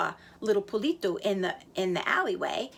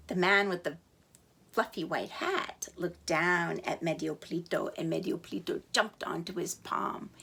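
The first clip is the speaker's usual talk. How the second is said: ordinary delivery, nothing marked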